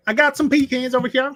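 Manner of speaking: high, loud Southern hawker; mock Southern accent. Offering pecans to the group, in a mock Southern accent. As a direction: play up a Southern twang on pee-cans.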